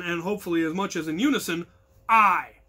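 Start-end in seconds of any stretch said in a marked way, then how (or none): 2.1-2.6 sharp loud single-word yell; shouted response. Shown as a demonstration. Crew member yells the answer to the captain. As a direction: bark it back in unison. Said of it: "as loud as possible".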